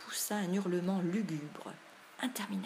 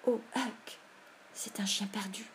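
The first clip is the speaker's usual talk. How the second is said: mildly choked, fearful voice; strained gasp sinking toward a whisper; strangled voice; 3 semitones higher than usual. A narrator's reading. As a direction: force it out through a tight throat. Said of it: "D'une voix étranglée".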